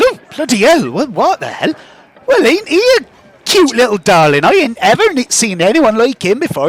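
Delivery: high, swooping cooing over a cute creature; startled, then gushing in a lilting high voice; halfling butcher utterly charmed; astonished halfling woman. Acted. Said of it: "takes a step back and says".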